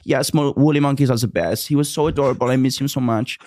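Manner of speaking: mimicking the monkey lady's fond praise. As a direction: gush over a lost pet. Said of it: "She goes".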